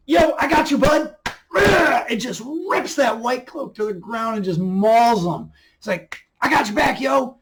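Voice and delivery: high, loud playful buddy voice; buddy wolf. Retold or imitated. Wolf tells Perrin he has his back, high and loud. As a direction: voice the wolf as your pal.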